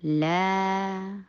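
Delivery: long recited لا; lengthened vowel. Shown as a demonstration. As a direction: hold the "aa" of لا. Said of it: "لمبا کر کے پڑھا جاتا ہے".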